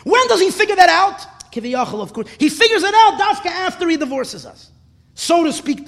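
high, loud sermon storytelling; impassioned preaching. Real, not acted. Rabbi telling how God cries after leaving, impassioned preaching.

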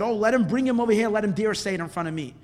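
loud, high, fast outburst; acted indignant boss; angry challenge. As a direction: dare him to face you. Leader angrily challenges the man.

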